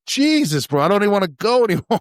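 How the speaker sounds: high squeaky mock panic; a retold terrified whine at giant wasps. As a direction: act freaked out and laughing.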